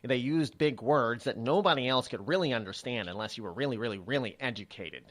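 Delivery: a light take on the "proper" talk Eddie Murphy mocked. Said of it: "they sort of talk like this".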